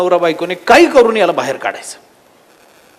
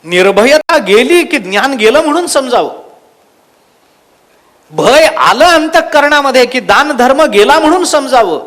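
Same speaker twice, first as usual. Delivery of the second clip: raised, emphatic sermon voice; preaching; 8 semitones higher, 5 dB louder than usual. Acted. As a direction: drive the moral home to the congregation. Preacher draws the moral forcefully. (जोरकस प्रवचन, ठासून सांगणे)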